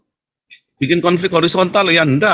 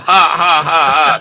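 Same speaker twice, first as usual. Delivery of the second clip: mock villain cackle; evil laugh; 2 semitones higher than usual. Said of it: "tertawa jahat".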